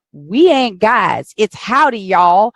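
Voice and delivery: Texan accent put on with a slow, punchy howdy; Texan women's retort. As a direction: mimic Texas women correcting a newcomer in their Texan accent. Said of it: "the women in Texas were like".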